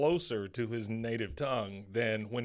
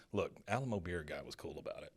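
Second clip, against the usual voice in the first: put-on dropped accent; 4 semitones lower, 8 dB quieter than usual. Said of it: "the guy cuts his accent and goes".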